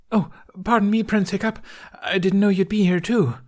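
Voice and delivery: put-on high, nervous voice of a bumbling boy; a flustered boy bowing while running; Fishlegs voice. Fishlegs nervously apologising to the prince.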